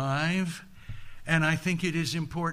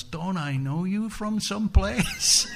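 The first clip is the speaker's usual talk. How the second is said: higher question, climbing playfully to the end; knowing challenge; 5 semitones higher than usual. Acted. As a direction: feign puzzlement with a rising lilt. Buddha challenges the daughters likewise.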